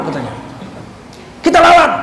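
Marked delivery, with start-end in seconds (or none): 1.4-1.9 higher, louder royal command to fight; angry king. Retold or imitated. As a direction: bark the order like a furious king. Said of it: "Oh, marahnya Raja Parsi". (perintah raja marah, lebih tinggi dan keras)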